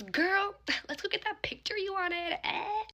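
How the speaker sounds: high, chirpy cousin impression; mimicked playful cousin. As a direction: imitate a cousin teasing, pitched well up. Imitates cousin's teasing invitation.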